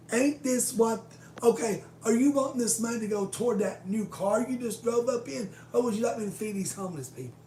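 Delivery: loud, high, indignant challenge; retold angry outburst. Retelling the fight with her boss, loud and indignant. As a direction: throw it in her face, fed up. Confronting the boss over homeless.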